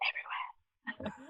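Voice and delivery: a slow, breathy whispered last word. Real, not acted. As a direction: drop to a slow whisper for a teasing finish. Playful ending to a plug.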